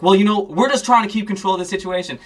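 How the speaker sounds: defensive cop. Retold or imitated. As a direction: rattle off the cop's excuse. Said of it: "And he was like".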